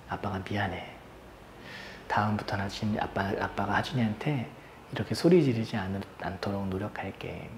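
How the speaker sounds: low, hushed, halting apology; tender apology. Retold or imitated. Dad apologising eye to eye to his son, soft and low. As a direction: speak softly, pausing between phrases, to a hurt child.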